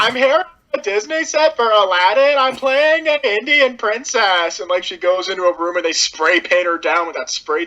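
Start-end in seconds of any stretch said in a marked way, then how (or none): 0.0-4.6 put-on high, bright Valley Girl accent; ditzy Valley Girl. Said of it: "with, like, Valley Girl voices".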